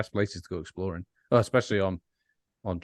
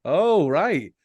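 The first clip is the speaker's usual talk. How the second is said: higher, louder sarcastic "oh right"; a hint of dry scorn, played up; mock sarcastic reply; 7 semitones higher, 6 dB louder than usual. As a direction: brush him off with mock cheer. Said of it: "and I'm like".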